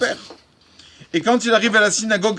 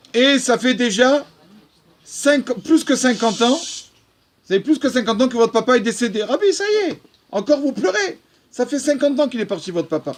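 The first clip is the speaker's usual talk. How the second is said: incredulous pleading; 5 semitones higher than usual. Retold or imitated. Congregant asks the rabbi why he still cries, incredulous and higher. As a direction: press the rabbi in disbelief, still weeping after fifty years.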